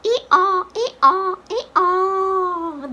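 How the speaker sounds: sing-out ee-aw, last one drawn out; donkey calls ending on a long falling aw; donkey bray. Shown as a demonstration. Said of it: "The donkey says".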